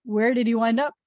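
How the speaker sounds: ordinary talk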